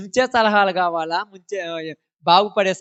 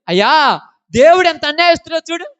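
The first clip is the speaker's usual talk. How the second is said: higher and louder mock complaint; quoting Eve; 7 semitones higher, 7 dB louder than usual. Preacher voicing Eve complaining to Adam, loud and high. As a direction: cry out against God in mock outrage. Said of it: "ఏమన్నదంటే".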